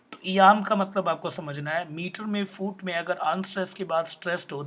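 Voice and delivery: ordinary talk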